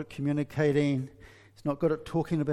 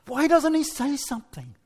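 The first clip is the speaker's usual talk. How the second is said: higher, louder pleading question; frustrated wife; 10 semitones higher, 5 dB louder than usual. Retold or imitated. Preacher voicing the wife longing for him to talk. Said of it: "she's thinking".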